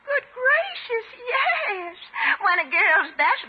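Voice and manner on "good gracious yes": gushing. Acted. Over-bright cry of reassurance.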